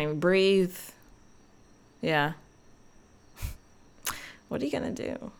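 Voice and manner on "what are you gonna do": whispering. Shown as a demonstration. Question repeated, first in a whisper.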